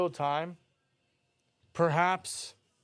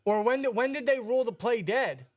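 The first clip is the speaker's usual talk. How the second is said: frustrated; 6 semitones higher, 5 dB louder than usual. Real, not acted. Arguing the ruling.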